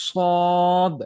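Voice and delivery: সদ said thick and loud; thick, emphatic সদ; heavy sad. Shown as a demonstration. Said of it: "এটাকে একটু মোটা করে পড়ব".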